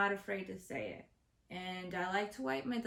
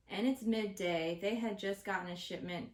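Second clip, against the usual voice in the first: usual speaking voice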